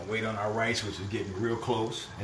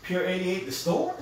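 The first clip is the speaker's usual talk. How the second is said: surprised question; 8 semitones higher than usual. Real, not acted.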